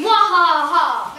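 slow loud evil cackle; evil laugh. Acted. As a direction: laugh like a cartoon villain. Villain laugh.

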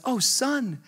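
put-on high, soft old-lady voice; gently imitated old woman; little grandmother. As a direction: coo it like a grandmother. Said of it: "She looks back at me and she says".

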